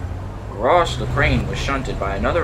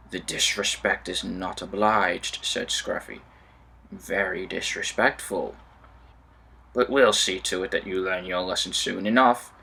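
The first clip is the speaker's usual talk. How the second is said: scheming trucks; 6 semitones lower, 4 dB quieter than usual. Acted. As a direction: plot revenge under the breath. Sneaky trucks plotting.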